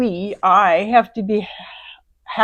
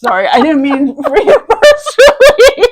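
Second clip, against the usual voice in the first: loud, high, talking through laughter; laughing; 8 semitones higher, 10 dB louder than usual. Real, not acted. Apologizing to the other speaker for a personal-sounding question, laughing. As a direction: apologize while cracking up, voice climbing. Shared laughing fit over success.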